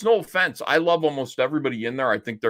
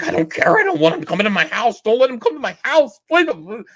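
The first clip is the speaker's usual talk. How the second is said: much higher and louder, imitating a panicked plea; hammed-up desperate begging; mock pleading; 9 semitones higher, 4 dB louder, faster than usual. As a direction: beg shrilly like a man in a meltdown. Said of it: "Vito's just like".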